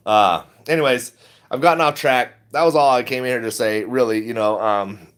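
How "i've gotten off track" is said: ordinary talk, nothing marked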